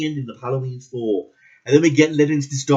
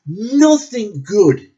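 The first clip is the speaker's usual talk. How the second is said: "Nothing good" stretched very slowly, high and louder; drawn-out emphasis; 6 semitones higher, 4 dB louder, much slower than usual. Real, not acted. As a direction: drag out every syllable of "Nothing good" in disgust. Repeating his verdict with emphasis.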